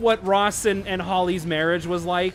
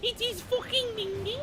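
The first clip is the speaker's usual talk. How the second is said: put-on high, hammy British accent; overdone British slang; bad British accent; 12 semitones higher than usual. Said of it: "really bad British accents".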